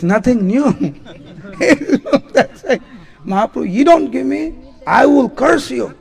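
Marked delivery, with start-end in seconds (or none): 3.2-5.9 raised, laughing mock threat; hammed-up playful curse threat, laughing. Girls threatening Mahaprabhu, playful laughing mock threat.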